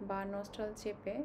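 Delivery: ordinary talk, nothing marked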